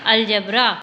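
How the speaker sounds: normal talking voice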